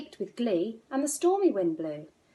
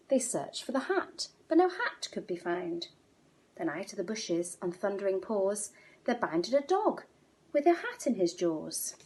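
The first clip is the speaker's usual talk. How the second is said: usual speaking voice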